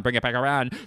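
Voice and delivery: movie crew impression. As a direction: repeat it back in a near-normal voice. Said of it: "here's my impression of".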